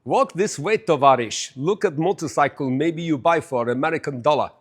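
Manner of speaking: comic put-on Russian accent; hammed-up Russian accent in broken English. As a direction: play a Russian haggling over a sale. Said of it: "That was my Russian accent".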